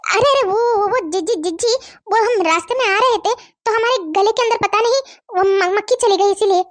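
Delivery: stuttered, fumbling excuse; stammering. Acted. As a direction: stumble on repeated syllables while making an excuse. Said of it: "तुम काहे इतना हकला रही हो भाई".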